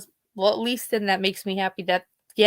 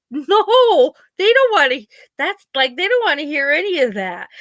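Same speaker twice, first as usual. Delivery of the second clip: high, louder exasperated pushback; exasperated protest; 7 semitones higher, 5 dB louder than usual. Retold or imitated. Daughter refuses dad's idea to call the priest, high and exasperated. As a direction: push back on calling the priest. Arguing with dad about priest.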